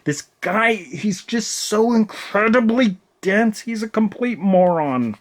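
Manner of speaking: high, strained exasperation; worked-up, incredulous rant; exasperated. Real, not acted. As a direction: draw out the disbelief at his stupidity. Ranting about a dense character.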